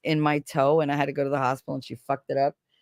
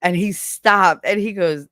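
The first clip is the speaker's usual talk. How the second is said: usual speaking voice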